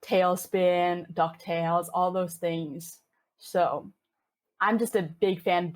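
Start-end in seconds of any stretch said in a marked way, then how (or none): none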